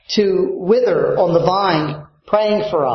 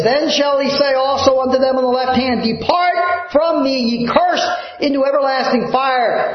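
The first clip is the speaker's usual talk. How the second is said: high, solemn scripture proclamation; scripture read in preaching; 6 semitones higher than usual. Acted. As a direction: proclaim the judgment words.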